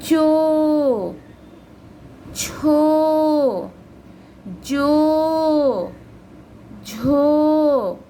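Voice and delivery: चो, छो, जो, झो each drawn out like a chant; sing-song classroom recitation of चो छो जो झो; reading syllables aloud. Shown as a demonstration. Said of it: "अगले वर्ग को देखिए".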